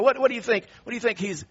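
ordinary talk, nothing marked

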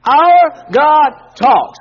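"Our God talks" drawn out and loud for the echo; slow loud call and response. Shown as a demonstration. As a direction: lead the congregation through it word by word. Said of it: "Say that with me".

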